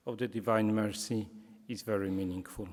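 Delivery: ordinary talk, nothing marked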